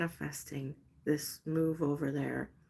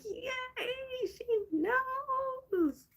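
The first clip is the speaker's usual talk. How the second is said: very high, squeaky cheer of happy spirits; tiny excited spirit voices; squealing spirits; much slower than usual. Pretend. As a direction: voice the spirits jumping for joy. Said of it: "They're all like".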